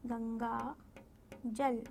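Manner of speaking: put-on teacher slowly sounding out गंगाजल for children; drawn-out demo. Shown as a demonstration. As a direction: spell out गंगाजल at a crawl so the nasal is heard. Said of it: "अंग".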